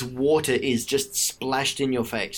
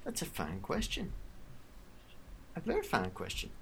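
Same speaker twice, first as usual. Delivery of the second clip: purring villain; 13 dB quieter than usual. Acted. Villain answers captive.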